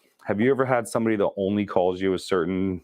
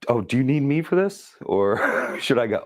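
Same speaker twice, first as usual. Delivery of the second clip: sarcastic; 6 semitones higher than usual. Retold or imitated. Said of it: "I'm like".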